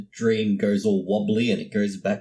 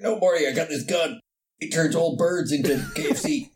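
raised, hammed-up cartoon scientist; Rick; 6 semitones higher than usual. Friend as Rick boasts to Morty about a gun, hammed-up cartoon voice. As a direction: do a pitched-up impression of a ranting cartoon inventor. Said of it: "He sounds like an Adult Swim cartoon character".

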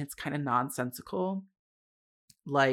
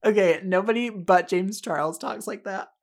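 louder words through real laughter; laughing while talking; 5 semitones higher, 7 dB louder than usual. Real, not acted. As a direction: still cracking up as she speaks. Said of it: "after laughing that hard".

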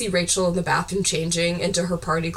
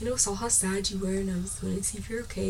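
hushed retelling; 7 dB quieter than usual. Retold or imitated. Retold movie dialogue, hushed.

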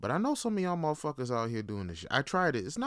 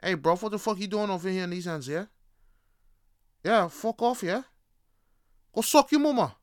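mock London roadman, pitched up; Top Boy London accent; 5 semitones higher, 4 dB louder than usual. Putting Top Boy shit on a suspected fed, mock London accent. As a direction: threaten in a put-on London street accent. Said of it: "Put some Top Boy shit on you".

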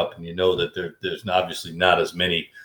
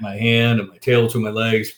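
ordinary talk, nothing marked

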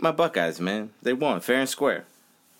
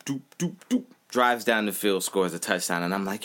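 ordinary delivery

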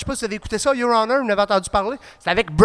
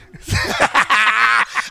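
roaring laugh; 3 semitones higher than usual. Real, not acted. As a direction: break up laughing at the joke. Bursts out laughing at joke.

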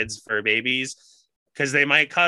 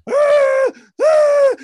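very high, loud howling hound; dog hooting; 11 dB louder than usual. Retold or imitated. Husband imitates the dog hooting outside the bedroom. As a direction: howl like a hound left out. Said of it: "And he's like".

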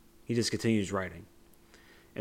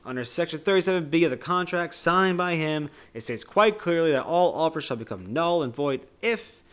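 higher, louder hammed-up legal rant; mock angry yelling; 7 semitones higher, 6 dB louder than usual. Retelling Wonka reading the contract, higher and louder mock rant. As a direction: rattle off the contract in a rage. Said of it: "like, screaming".

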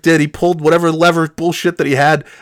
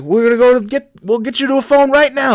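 higher-pitched trucker voice; imitated trucker; 7 semitones higher than usual. Trucker promising a ride to a phone, imitated trucker.

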